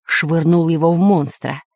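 ordinary delivery, nothing marked

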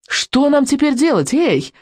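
high-pitched call into the dark. A narrator's reading. Boy gets no answer from the witch, calling out. Boy calls again into silent darkness.

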